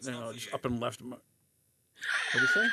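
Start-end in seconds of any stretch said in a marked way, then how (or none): 2.3-2.7 high laughing yell; shrieking laugh; laughing shout. Real, not acted. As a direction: burst out laughing as you ask. Laughing shout mid-story.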